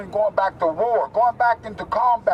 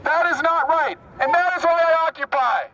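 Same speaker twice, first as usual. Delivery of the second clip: rally speech declamation; 8 semitones higher than usual. Real, not acted. Activist addresses an Occupy rally, declaiming phrase by phrase. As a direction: declaim in short phrases with long pauses.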